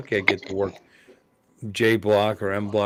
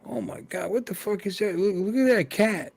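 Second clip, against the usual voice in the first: put-on astonished young guy; 10 semitones higher than usual. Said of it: "looks at, goes".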